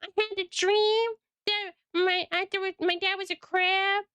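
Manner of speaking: high, far-off squeaky character voice; annoying high-pitched voice. Retold or imitated. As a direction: squeak it out like a silly cartoon. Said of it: "You're doing the voice really good".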